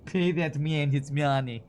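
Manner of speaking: slow, heavy mock Russian accent, hamming up a movie line; John Malkovich Russian accent. Streamer quoting Malkovich's card-table line, slow mock Russian accent. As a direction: lean into a bad Russian accent, drawn out. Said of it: "John Malkovich's bad accent".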